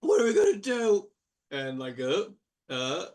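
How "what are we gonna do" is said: high, wailing mock lament; a sky-high fake sob of a brother; hammed-up grief. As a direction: overplay the brother's despair, ending in a shout. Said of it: "falls to his knees and goes".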